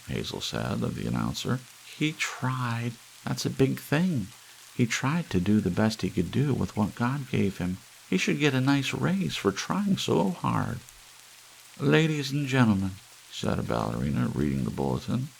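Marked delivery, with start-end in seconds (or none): 2.0-10.8 lightly higher, simple-minded wife; a touch of a dim, kindly housewife; Hazel's voice. Acted. Hazel coaxing her husband, lightly voiced higher. 11.8-13.0 a higher, fuller reading voice; ballerina's lovely voice. Acted. Ballerina reading the bulletin, higher and fuller. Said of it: "had to apologize at once for her voice".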